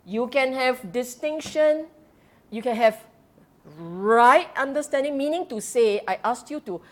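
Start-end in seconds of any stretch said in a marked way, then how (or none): none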